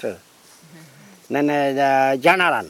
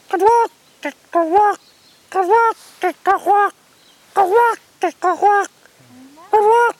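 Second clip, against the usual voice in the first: very high two-beat squawk; bird squawk; slower than usual. Retold or imitated. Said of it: "He go".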